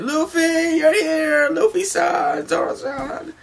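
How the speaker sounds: very high, gushing cries of welcome; Brook overjoyed. Acted. Brook greets his returning crewmates, overjoyed high voice. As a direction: squeal the names in delight.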